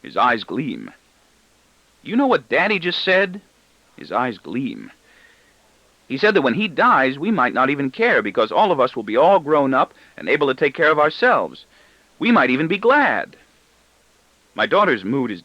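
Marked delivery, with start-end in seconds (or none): 2.0-3.4 put-on excited boy. A narrator's reading. Boy excitedly telling sister. 6.1-13.4 put-on excited boy. A narrator's reading. Boy excitedly retelling father's words.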